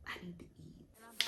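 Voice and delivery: hushed, slow whisper; whispered confession. Acted. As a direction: drop to a slow, pointed whisper. Confesses getting hangry when hungry.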